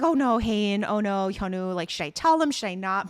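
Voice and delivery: loud, anxious fretting over names; mock fretting. Grace torn over telling Hain and Hyunwoo, loud mock fret. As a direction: fret out loud, half-panicked. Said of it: "She's like".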